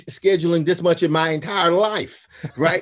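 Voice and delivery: ordinary talk, nothing marked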